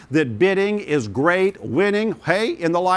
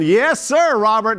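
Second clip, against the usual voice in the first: high, loud cry of delight; excited shout; 6 semitones higher, 4 dB louder, slower than usual. Real, not acted. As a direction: cheer the new bid like a win. Auctioneer hails a new bid.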